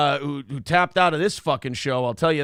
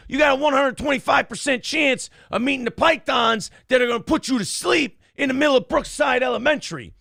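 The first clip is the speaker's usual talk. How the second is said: Scott Steiner impression; 7 semitones higher than usual. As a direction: bellow it like a wrestling promo. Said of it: "That, that was an impression".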